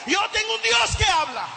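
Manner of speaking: preaching shout. Acted. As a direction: cry out Dios like a climax. Preacher's climax, repeated claim. (grito de predicador, más fuerte y agudo)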